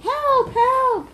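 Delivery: storybook crowd shrieking lightly for help; high-pitched cry for help. A narrator's reading.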